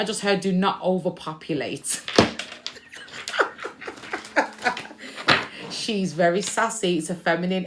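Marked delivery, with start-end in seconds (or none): 2.1-5.8 breathy burst of laughter; amused unvoiced laugh; airy laugh. Real, not acted. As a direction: laugh it out in a whisper-like huff. Laughs at spirit's sass.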